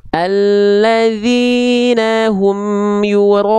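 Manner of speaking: "Alladzina hum yura'un" dengan mulut monyong, panjang dan lambat; contoh salah. Shown as a demonstration. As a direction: meniru bacaan tebal yang salah, lebih tinggi dan keras. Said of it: "Nggak gitu huruf tebal". (guru mencontohkan cara keliru dengan mulut maju)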